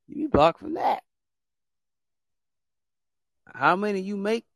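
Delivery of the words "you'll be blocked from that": squealed threat. Real, not acted. Host warning alt accounts.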